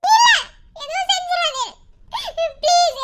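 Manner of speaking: high-pitched frantic begging; desperate plea. Acted. Begging kidnappers to spare the child.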